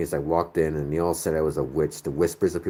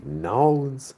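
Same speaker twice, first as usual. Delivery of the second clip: Nolans, pitched up in a playful Southern lilt; Southern twang; 10 semitones higher, 3 dB louder than usual. Retold or imitated. Northerner delighting in the New Orleans twang, playful Southern lilt. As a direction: sing out Nolans like a charmed New Orleans local. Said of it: "I just love that Southern twang".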